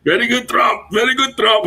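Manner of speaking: high, hammed-up echo of the catchphrase; mock Trump catchphrase. Repeating a friend's joke to him, high and mocking.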